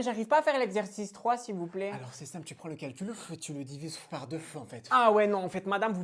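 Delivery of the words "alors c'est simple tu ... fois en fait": mock teacher. Imite la prof.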